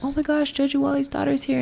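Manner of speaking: excited fan quote. Retold or imitated. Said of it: "Everywhere I went".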